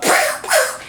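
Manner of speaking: high unvoiced mock "Pow, pow" gunshots; gunshot sounds. Retelling the movie's shooting, mimicking the gunfire high and breathless. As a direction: puff out the shots like a kid playing guns. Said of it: "and goes".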